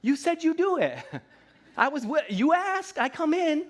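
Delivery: high, laughing plaintive voice; whiny complaint. Retold or imitated. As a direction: whine to God that he wasn't there. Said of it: "And then we're like".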